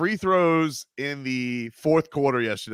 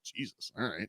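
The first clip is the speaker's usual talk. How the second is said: a quiet, stunned aside; hushed quoted reaction; 15 dB quieter than usual. Retold or imitated. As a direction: say it under your breath, amazed. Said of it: "I was like".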